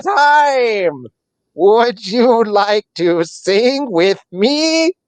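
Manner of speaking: high, slow, stuttering broken-machine voice; glitchy robot possum. Acted. GM voicing the possum greeting visitors, high glitchy stutter. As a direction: stammer like a jammed clockwork toy.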